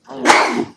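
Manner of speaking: loud sudden sneeze; sneezing. Real, not acted. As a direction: let out a sharp, real sneeze. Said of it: "Sorry, I'm gonna sneeze".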